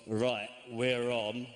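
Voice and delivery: grim resolve. Retold or imitated. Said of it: "I thought".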